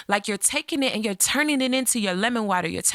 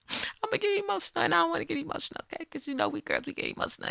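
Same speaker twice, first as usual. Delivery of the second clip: getting emotional; 8 semitones higher, 7 dB quieter, much faster than usual. Real, not acted. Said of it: "'cause I'm gonna get emotional".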